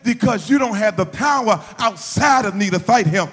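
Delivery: loud, high-pitched sermon shout; full-force preaching. Real, not acted. Preacher tells how father said to call on him, shouting. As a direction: drive it home to the congregation at full volume.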